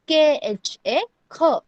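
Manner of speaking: each letter spaced out for learners; slow spelling out. Shown as a demonstration. Teacher spelling ख slowly for online students. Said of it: "ख को कैसे pronounce करना है?".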